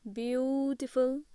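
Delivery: "beautiful" stretched very slowly, syllable by syllable. Shown as a demonstration. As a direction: draw out "beautiful" for learners. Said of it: "pronunciation उन्होंने यहाँ पे दे दिया है".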